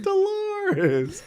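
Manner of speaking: Jerry's realization. Retold or imitated. Friend imitating Jerry suddenly remembering her name. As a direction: blurt the name after she leaves. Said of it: "he's like".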